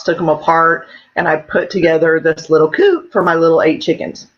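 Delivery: ordinary delivery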